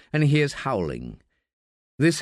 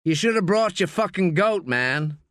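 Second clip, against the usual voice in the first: lightly voiced American calling out, higher pitch; raised-pitch call in an American accent; 8 semitones higher than usual. A narrator's reading. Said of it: "calls out in an American accent".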